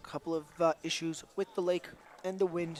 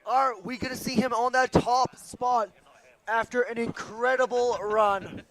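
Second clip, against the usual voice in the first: sports commentator; 5 semitones higher, 9 dB louder than usual. Acted. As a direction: build suspense like a TV announcer.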